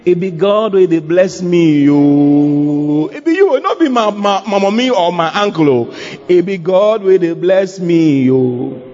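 heartfelt pidgin praise with drawn-out "oh"; grateful believer. Acted. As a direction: exclaim it to God, stretching the phrase endings. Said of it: "Just imagine telling the Lord that".

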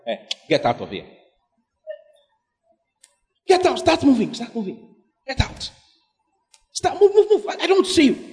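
playing God shooing someone away, pitch jumping high; mock-angry God: get out, move, move; hammed-up divine rejection, shooing her off; God dismissing her. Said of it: "I look at it, I'm like".

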